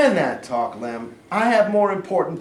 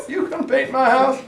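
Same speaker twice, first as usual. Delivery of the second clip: laughing; 9 semitones higher, 3 dB louder than usual. Acted.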